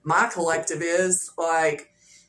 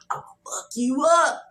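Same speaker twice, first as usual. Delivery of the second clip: playful whispered threat; mock threat whispered; 6 semitones higher than usual. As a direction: hiss the threat as a joke. Joking threat over cornbread.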